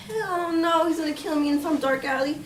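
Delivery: high re-enacted alarm; alarmed refusal. Victim ordered along by gunman, re-enacted high alarm. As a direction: balk in mild panic, pitch up.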